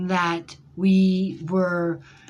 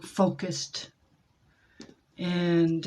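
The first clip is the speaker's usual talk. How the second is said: ordinary talk, nothing marked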